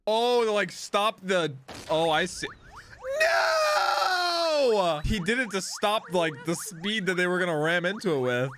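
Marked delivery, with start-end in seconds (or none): 3.2-5.0 high, long wailing no; dramatic high shout of dismay; drawn-out cry of dismay. Real, not acted. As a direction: wail it high, falling at the end. Reacts to impending crash.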